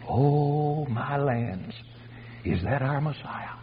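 very soft, acted amazement; hushed awe. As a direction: breathe it out in quiet wonder. Awed old couple watching Jesus.

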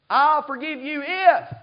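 slow, loud mock grudging "I'll forgive you if"; mock conditional forgiver. Preacher mocking a grudging forgiver, slow and loud.

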